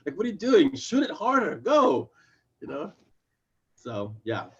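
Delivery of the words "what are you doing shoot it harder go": high, urgent bossy command; exasperated order, pitched well up and pushed; Monty. Acted. Monty telling Simon what to do, urgent and exasperated. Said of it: "exactly what Monty would tell Simon to do".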